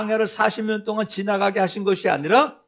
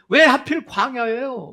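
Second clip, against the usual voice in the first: loud, high, drawn-out complaint; resentful grumbling; 5 semitones higher, 7 dB louder than usual. Acted. Israelites blaming God for the wilderness, resentful grumbling. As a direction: whine it out slowly and loudly. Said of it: "불평하고 원망하고".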